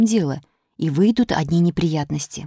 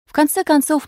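ordinary delivery

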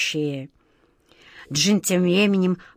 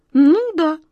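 much higher, louder forced calm; 8 semitones higher, 4 dB louder than usual. A narrator's reading. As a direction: try to sound sure. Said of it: "стараясь казаться спокойным и уверенным". (нарочито спокойно)

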